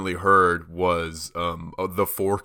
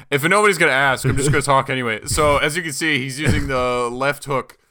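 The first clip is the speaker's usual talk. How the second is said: louder, higher mock anime commentator; exasperated exposition guy; 7 semitones higher, 5 dB louder than usual. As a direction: push on loudly as the unasked explainer. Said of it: "he was just like".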